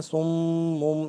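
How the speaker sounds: long hummed nasal m in صُمٌّ; ghunna. Shown as a demonstration. Teacher points out the ghunna, humming the held m. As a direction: hold the doubled m of صُمٌّ through the nose. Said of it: "یہاں پہ غنہ ہو رہا ہے".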